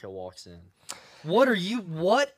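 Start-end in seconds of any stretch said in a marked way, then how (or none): 1.2-2.3 loud, high mock outrage at the newcomer; mock indignant shout. Imagined churchgoer loudly confronting the man in the yarmulke, mock outraged shout. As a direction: bellow it like an offended churchgoer.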